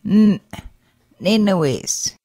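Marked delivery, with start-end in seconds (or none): 0.1-0.4 "n," cut short by a glottal stop; a short "n," with a sudden throat stop; abruptly ended N. Shown as a demonstration. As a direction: clip the "n," off hard. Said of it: "pronounced as an abruptly ended N".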